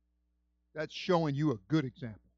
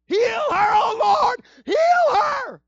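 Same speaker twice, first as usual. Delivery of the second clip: very high, loud anguished cry; Moses crying out; 14 dB louder, much slower than usual. Acted. Moses crying out to God for Miriam. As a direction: wail it to heaven.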